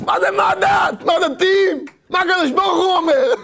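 high, exclaimed correction; indignant retort. Acted. Preacher retorting to those asking what the religious say, indignant. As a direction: throw the question back in indignation.

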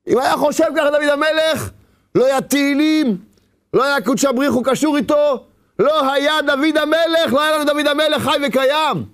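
high, impassioned sermon voice; fervent preaching. Acted. Preacher at full force.

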